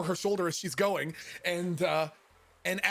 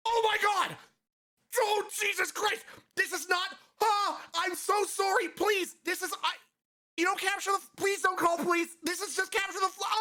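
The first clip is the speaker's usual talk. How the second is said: high-pitched, horrified pleading; panicked apology; 13 semitones higher than usual. Retold or imitated. Man apologizing to terrified stranger, panicked pleading. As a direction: beg forgiveness in a squealing panic.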